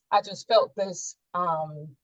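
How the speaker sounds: normal talking voice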